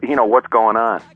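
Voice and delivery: ordinary talk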